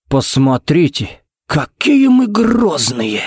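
slow sing-song taunt, pitch swooping high; sighed mockery. A narrator's reading. Jared teasing the sulking rival, mock-sighing. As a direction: draw it out with an exaggerated sigh. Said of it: "вздохнул Джаред".

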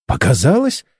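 incredulous question, rising sharply at the end; indignant echo. A narrator's reading. Startled partner challenges the engineer's excuse, indignant. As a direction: throw the word back as a reproachful question. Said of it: "возмутилась Чен с укором".

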